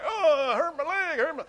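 mock injured player. Player on the ground after a hit, hammed-up crying whine. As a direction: whine like a player milking a small knock.

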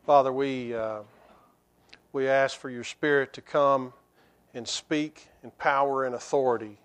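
quiet, low earnest prayer. Real, not acted. Pastor praying for the Spirit before the sermon, hushed and low. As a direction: pray softly over the congregation.

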